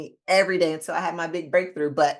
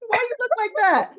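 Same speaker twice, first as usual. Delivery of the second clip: put-on much higher child's voice; little son. Son to his mom, high child's voice. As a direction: play her five-year-old calling her out. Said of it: "He was like".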